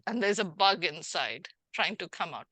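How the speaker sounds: ordinary talk, nothing marked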